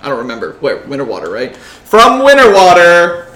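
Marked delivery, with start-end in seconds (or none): 1.6-3.3 loud, slow boxing announcer call; ring announcer. Acted. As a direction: stretch every word for the crowd. Announcing fighters in the arena.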